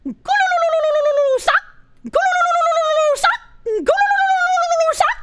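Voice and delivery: high, trilling bird warble with a clipped yelp; silly made-up bird call, high warble and a yelp; click voice bird. Pretend. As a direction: trill your tongue high and fast, then yelp the word. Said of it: "I'd probably be like".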